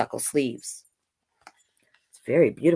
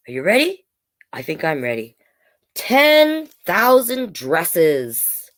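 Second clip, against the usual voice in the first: ordinary delivery, nothing marked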